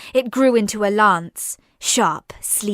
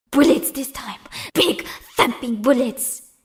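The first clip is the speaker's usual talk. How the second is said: fierce determination; 4 semitones higher than usual. Acted. Girl wills her blood weapon.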